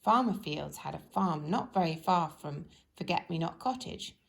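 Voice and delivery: ordinary delivery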